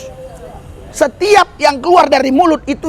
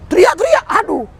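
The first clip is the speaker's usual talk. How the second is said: high mock cry; mild imitation of a cancer patient crying out; imitated screaming; 9 semitones higher than usual. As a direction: yelp it high like a patient in pain. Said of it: "orang-orang kanker stadium empat".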